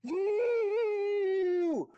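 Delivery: very high, loud, long-held scream; drawn-out manager's shriek. Acted. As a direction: scream the accusation across the store. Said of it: "he was screaming".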